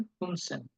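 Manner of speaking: ফুনসেন said very soft with rounded lips; soft rounded German. Retold or imitated. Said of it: "মুখটারে গোল করে বলে".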